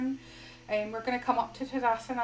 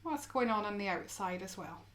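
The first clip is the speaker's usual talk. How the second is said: soft, unhurried yoga guidance with long pauses; guided meditation; 10 dB quieter than usual. Acted. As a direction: gently guide them into stillness, phrase by phrase.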